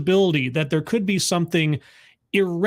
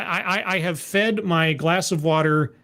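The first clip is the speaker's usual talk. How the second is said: ordinary delivery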